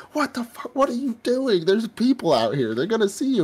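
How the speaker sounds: high-pitched mock alarm; alarmed scolding. Friend warns him people will see him, high mock alarm. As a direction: squeak out a panicked telling-off.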